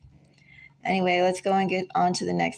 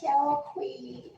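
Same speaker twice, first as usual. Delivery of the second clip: very high, soft cooing; 7 dB quieter than usual. Real, not acted. Owner greeting her just-woken cat, high soft coo. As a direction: coo softly up high at the kitty.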